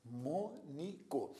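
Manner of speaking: mnemônico in slow separate syllables, mne, mô, ni, co; measured breakdown of mnemônico with mn together. Shown as a demonstration. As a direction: softly sound out mnemônico, pausing after each syllable. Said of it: "na mesma sílaba".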